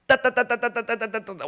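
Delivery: rapid rattling putt-putt of an engine; motorbike engine noise. Retold or imitated. (오토바이 소리 흉내)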